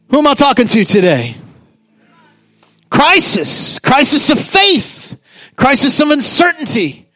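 impassioned preacher, rising intensity; preaching. Acted. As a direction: preach it to the crowd. Preaching at full force.

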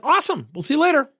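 bright, high upbeat goodbye; cheerful, energetic send-off. Retold or imitated. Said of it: "I'm an upbeat, hyper guy".

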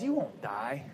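low, soft sly serpent; a low, coaxing snake whispering to Eve. Acted. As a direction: tempt her in a low hushed hiss. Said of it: "And the snake says".

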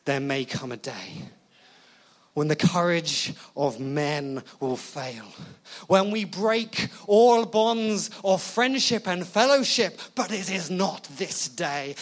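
hammed-up warrior king's oration; rousing battle speech. General inspiring courage in his men, rousing battle speech. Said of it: "that is what a general ought to do".

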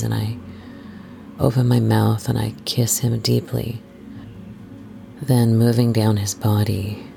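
ordinary delivery

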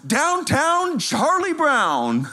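high, drawn-out mock yell; retold coach's yell. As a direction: imitate the coach crowing slowly. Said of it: "And then he yells".

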